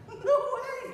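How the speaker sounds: very high squeal of surprise; amazed disbelief. Retold or imitated. Priest hearing his flight is reinstated, amazed disbelief. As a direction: let the voice leap up, incredulous.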